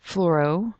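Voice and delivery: ordinary talk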